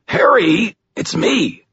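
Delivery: high, strained cry; pained plea. A narrator's reading. Pinned man begging his attacker to recognize him, pained.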